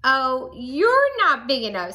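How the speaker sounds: grouchy retort. A narrator's reading. Grouchy ladybug backs off.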